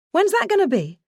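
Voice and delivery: high, pressing question; impatient. Retold or imitated. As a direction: demand a time, eager. Impatient exchange with boss.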